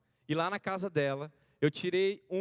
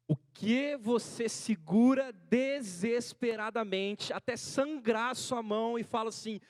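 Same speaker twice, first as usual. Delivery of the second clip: raised, higher preaching voice, slow in places; fervent preaching; 6 semitones higher, 4 dB louder, much slower than usual. Acted. Preacher urging the congregation not to give up, fervent preaching. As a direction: drive each point home from the pulpit. Preacher challenges listeners' idols.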